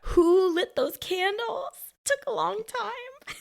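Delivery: high, slow mock wonder; sarcastic. Real, not acted. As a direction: marvel at it sarcastically. Sarcastic question about candles.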